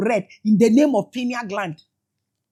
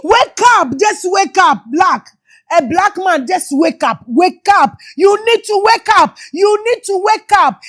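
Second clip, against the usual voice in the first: shouting; 4 semitones higher than usual. Real, not acted. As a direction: rouse them, shouting it out. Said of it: "This is why I am shouting".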